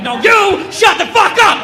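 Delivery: high, slow mock yell in caps. Chat room troll yelling back, high mock yell. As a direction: shout it like an all-caps chat reply. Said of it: "you know they're yelling at you".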